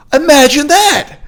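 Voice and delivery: high, loud fake astonishment; sarcastic mock amazement. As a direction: exclaim it as if it were big news. Sarcastic exclamation after reading a complaint.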